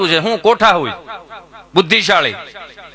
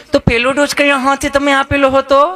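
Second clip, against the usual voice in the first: higher, gentle questioning; a hint of a sweet nurse; 5 semitones higher than usual. Nurse kindly asks Gabho about his first dose. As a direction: coax the patient kindly.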